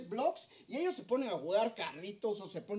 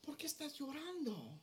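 put-on higher and much softer, a child's concern; caring child; 4 semitones higher, 11 dB quieter than usual. Preacher voicing a child comforting someone who cries, higher and softer. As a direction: ask gently like a worried little kid. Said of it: "y dice".

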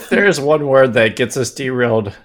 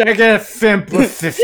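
slurred, high mocking repeat; drunk Orson Welles; 10 semitones higher than usual. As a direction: imitate a drunk, boomy announcer. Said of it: "sound like a drunk Orson Welles".

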